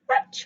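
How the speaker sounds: very high, sharp sneeze; giant sneeze. A narrator's reading. Narrator voices the bear's giant sneeze, shrill and sudden. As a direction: let the bear sneeze huge. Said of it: "It's a giant sneeze".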